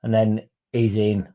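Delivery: normal talking voice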